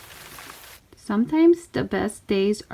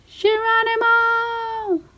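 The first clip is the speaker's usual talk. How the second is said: high, long whoop of Geronimo; gleeful shout; 13 semitones higher, slower than usual. Acted. Friends jumping into the mud hole, a high gleeful yell. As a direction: leap in yelling it high and stretched.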